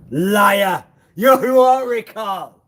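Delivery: loud, high, drawn-out accusation; accusatory outburst. Real, not acted. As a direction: cry it out slowly, high and loud. Heated accusation of lying.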